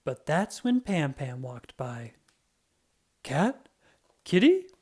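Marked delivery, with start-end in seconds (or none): 3.2-4.7 high, coaxing kitty call. Acted. Pam Pam calls to Roy as if he were a cat, coaxing high. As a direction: coo at him as at a cat.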